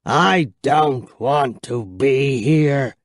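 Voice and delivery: vampire refusing to stay; declaring vampire. A narrator's reading. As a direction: state it gravely like an old count. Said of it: "he declared".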